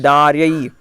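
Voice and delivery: दार्यै with the r before यै; correct pronunciation. Shown as a demonstration. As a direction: stress दार्यै, r first. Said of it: "दायैर् नहीं बोलना है".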